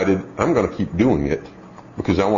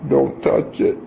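lightly voiced toddler, a bit higher; small child answering; 7 semitones higher, slower than usual. Dad voicing his small son repeating the rule, mildly childlike. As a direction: answer like a little boy caught out.

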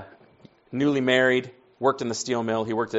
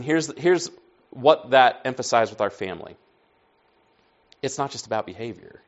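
ordinary talk, nothing marked

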